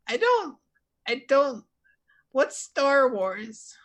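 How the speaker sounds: high, halting, drawn-out question; sheepish kid Timmy. Acted. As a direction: confess timidly that you are clueless. Timmy admits not knowing.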